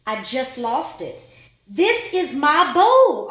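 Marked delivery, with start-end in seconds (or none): 1.7-3.3 loud, slow, high scream; furious fish screaming. A narrator's reading. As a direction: scream it out, fed up. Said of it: "I screamed".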